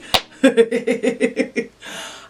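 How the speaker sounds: high giggle after squealing. Real, not acted. Vlogger laughing at herself before apologising, high giggle. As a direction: giggle at your own silly squeal.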